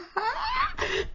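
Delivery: a high-pitched squeal of a laugh. Real, not acted. Gushing over the tiny charm, high squealing laugh. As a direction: let out a squeaky giggle of delight.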